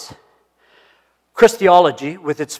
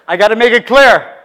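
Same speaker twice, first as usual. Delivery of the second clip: louder, higher, driving the point home; emphatic louder repeat; 6 semitones higher, 9 dB louder than usual. Retold or imitated. Preacher repeating himself to the congregation, louder and emphatic. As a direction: hit it harder the second time.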